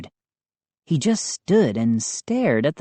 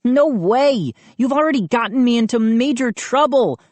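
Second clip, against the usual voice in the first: higher, fast protest; crying out; 9 semitones higher, 3 dB louder than usual. A narrator's reading. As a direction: blurt it out, quick and high. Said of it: "I cried".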